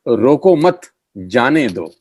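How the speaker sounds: "रोको मत" said as one unit, then "जाने दो"; group मत with रोको, then let जाने दो fall; pause after मत. Shown as a demonstration. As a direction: keep "रोको मत" together, stop, then release "जाने दो". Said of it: "वो पुरानी कहावत है".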